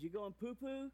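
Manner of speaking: put-on high little-girl question; toddler voice. Daughter asking daddy through the door, high toddler voice. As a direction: pipe it up like a curious toddler. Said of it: "She goes".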